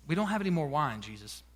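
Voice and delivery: low, understated statement with a hidden demand; pointed hint. Acted. Mother hinting to her son that he should act, low pointed hint. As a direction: softly drop a loaded hint, trailing right down. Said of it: "she raises her eyebrow".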